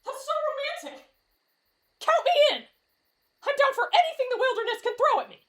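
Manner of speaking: high-pitched swoon bursting into eager cheer; gushing excitement. Acted. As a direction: squeal it, then declare yourself totally in. Smitten camper suddenly eager to join.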